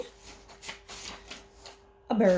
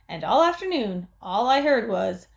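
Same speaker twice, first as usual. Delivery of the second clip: plain reading voice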